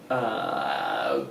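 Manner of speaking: usual speaking voice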